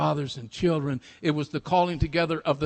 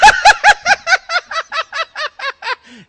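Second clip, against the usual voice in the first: very high, loud cackling laugh; acted uncontrollable giggling shriek; helpless laughter; 15 dB louder than usual. A narrator's reading. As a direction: let out a long squealing laugh. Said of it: "she was laughing so hard".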